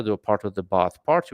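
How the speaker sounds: ordinary talk, nothing marked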